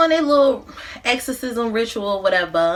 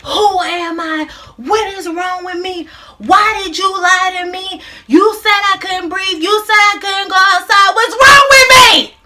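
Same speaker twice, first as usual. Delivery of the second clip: high, loud screaming building to a full shout; 10 semitones higher, 8 dB louder than usual. Acted. Son confronting his mother over her lies, screaming. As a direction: scream it in anguish, louder and higher to the end. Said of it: "he starts screaming at his mother".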